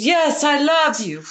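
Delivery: high, loud, drawn-out insistence; imitated emphatic declaration. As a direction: insist slowly, at the top of the voice. Acting witch doctor's insistent confirmation.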